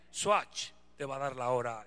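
low, slow, self-important announcement; presumiendo el reloj. Retold or imitated. Repite su respuesta presumida. (tono bajo y lento, presumiendo el reloj)

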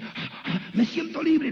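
imitated breathy gasps, then high joyful relief; amazed relief. Woman tells pastor she feels free, amazed relief.

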